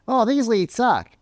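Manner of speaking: high, whiny complaining rep; mock whine of a lazy sales rep; whining salesman. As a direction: moan about the leads from way up high. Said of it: "are just gonna be like".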